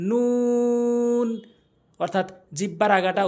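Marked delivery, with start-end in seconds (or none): 0.1-1.3 long, high, rounded "নুন"; নুন with rounded mouth. Shown as a demonstration. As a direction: hold "নুন" on one raised note. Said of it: "মুখ গোল করে". ("নুন", মুখ গোল করে টেনে)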